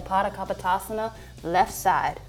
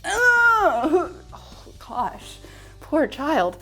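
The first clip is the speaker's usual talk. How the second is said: mock shock; 9 semitones higher, much slower than usual. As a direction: gasp it out in mock horror. Mock shock, [name].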